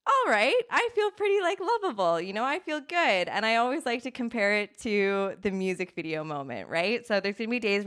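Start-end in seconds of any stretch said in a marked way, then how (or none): 0.1-3.3 high, swooping self-satisfied lilt; sing-song self-approval, pitched well up; pleased with oneself. Pretend. Coach voicing someone on a good day, pleased with themselves.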